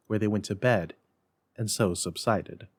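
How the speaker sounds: plain reading voice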